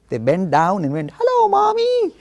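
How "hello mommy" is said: put-on very high, loud little-child greeting; sweet child voice. Schoolchildren bending to greet a snail, sweetly high. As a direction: coo it like a small child to a snail.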